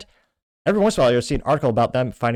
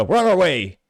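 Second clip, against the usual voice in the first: raised, loud mock dispatcher call; eager police reply; 7 semitones higher, 6 dB louder than usual. Police answer a call about online speech, eager mock reply. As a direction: call it out eagerly, higher and louder.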